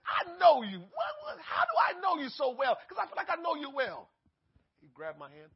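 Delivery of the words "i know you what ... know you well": excited greeting. Retold or imitated. Greeting a stranger warmly.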